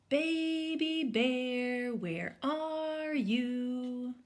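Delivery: high, slow, drawn-out call; calling from afar. A narrator's reading. Mom calling Baby Bear from the woods, slow. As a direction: call long across the woods.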